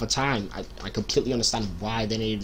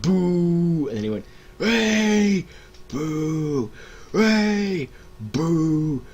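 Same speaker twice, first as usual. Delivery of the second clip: mock crowd boo; 6 semitones higher, much slower than usual. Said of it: "they were like".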